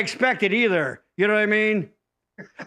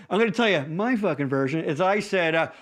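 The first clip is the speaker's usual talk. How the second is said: normal talking voice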